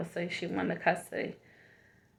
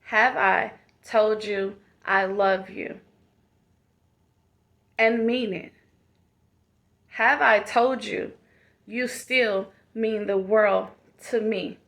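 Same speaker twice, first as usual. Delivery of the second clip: motivational affirmation. Acted. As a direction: speak each line like a loving reminder. Affirmation talk to the viewers.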